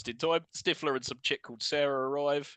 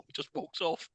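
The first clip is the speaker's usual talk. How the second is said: normal talking voice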